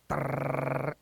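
low soft rolled dog purr; dog growl imitation. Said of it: "he goes something like".

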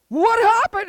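high, loud demand; outraged dad. Acted. Dad questioning his son about the smashed god, outraged. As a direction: shout the question in acted alarm.

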